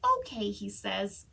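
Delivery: lively, expressive storybook reading; animated picture-book read-aloud; reading to children. Acted. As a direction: read it like story time for kids.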